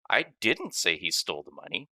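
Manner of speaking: "didn't" punched, the rest even; stress on didn't. Shown as a demonstration. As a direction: hit "didn't" hard as a denial. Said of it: "based on which word carries the emphasis".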